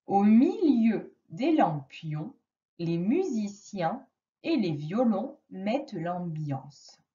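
the sentence reread slowly, "lampions" and "violons" drawn out; slow it right down so the child hears each sound. Shown as a demonstration. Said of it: "essaye de repérer les mots".

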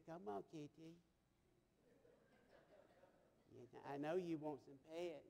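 hushed kitty-calling; soft coaxing. Retold or imitated. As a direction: gently coax a shy cat over. Coaxing a cat in story.

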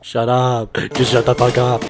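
loud, fed-up yell; exasperated shout. Real, not acted. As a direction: shout it in disbelief. Exasperated outburst at movie.

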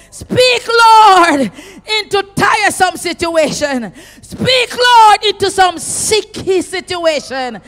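high, loud, pleading cries of Speak and Lord; shouted preaching prayer. Acted. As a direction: cry out each Speak, Lord at full force. Repeated Speak Lord petitions.

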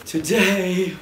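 loud, high announcement; excited. Acted. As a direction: shout the reveal with a grin. Hyped reveal of video.

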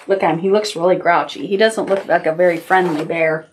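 usual speaking voice

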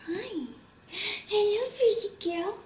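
high, soft cooing to a pet; cooing pet talk. Real, not acted. Vlogger greets the pet making her entrance, cooing.

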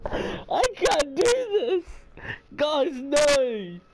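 high, loud, near-crying shout; panicked wail. Real, not acted. Player telling viewers he can't go on, panicked wail. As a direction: wail in real distress. Refusing after jump scare.

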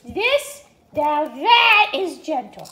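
slow emphatic approval. Shown as a demonstration. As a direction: stretch it out to show this is the gentle way. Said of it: "that wasn't gentle".

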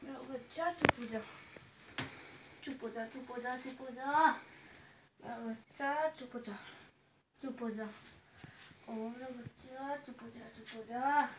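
put-on low, very soft baby-hushing murmur; soft soothing hush. Mother calming her crying baby, soothing and hushed.

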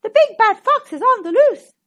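high, loud goose voice; Goose warning. A narrator's reading. Goose warns Hen about the fox, high loud goose voice. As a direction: keep the goose's frantic honk in it. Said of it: "cried Goose".

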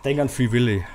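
ordinary talk

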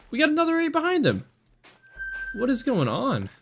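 high, fast excited exclaim; excited disbelief. Real, not acted. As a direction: pitch jumps up in amazement. Yet another eight appears.

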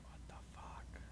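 barely audible whisper; stunned whisper. Real, not acted. Player stunned by the game, whispering. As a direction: whisper it under your breath in disbelief.

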